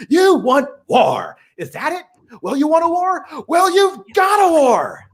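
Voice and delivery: high, rising angry speech ending in a shout; Sheridan's rant. Retold or imitated. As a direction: build a furious tirade line by line. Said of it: "you recited that one 'cause you're so good at it".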